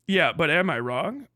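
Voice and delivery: ordinary talk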